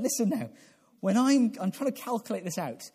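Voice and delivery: normal talking voice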